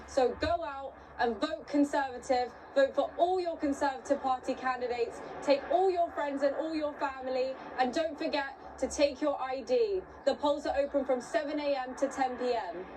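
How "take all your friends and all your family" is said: ordinary talk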